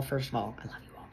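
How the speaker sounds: soft whisper of love to the viewers; tender whispered aside. Real, not acted. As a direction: drop to a breathy whisper.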